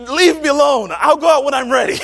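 high, loud yell with a laugh in it; frantic yell. Retold or imitated. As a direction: shout it, half laughing. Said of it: "And I was like".

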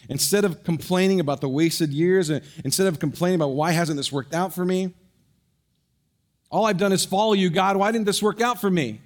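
loud, high pleading cry; impassioned preaching. Acted. Pastor voicing a bitter complaint to God, loud.

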